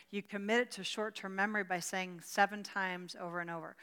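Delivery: ordinary talk, nothing marked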